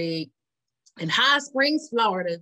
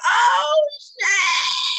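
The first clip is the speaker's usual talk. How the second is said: shocked exclaim; 8 dB louder than usual. Retold or imitated. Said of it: "I'm like".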